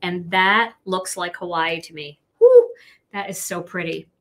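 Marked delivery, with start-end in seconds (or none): 2.4-2.7 high, loud cheer; excited whoop. Real, not acted. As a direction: let out a happy whoop. Excited at Hawaii-like card.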